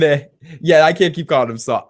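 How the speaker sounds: ordinary delivery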